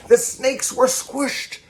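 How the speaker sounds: high, slow and pinched, a light squashed voice; squished snake. Acted. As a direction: squeeze the words out like a squished snake.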